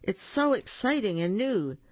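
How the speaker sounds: high, mildly shouted glee; excited shout. A narrator's reading. Boo shouts to his new friends, excited. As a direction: call out eagerly, a lightly acted shout. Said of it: "Boo shouted".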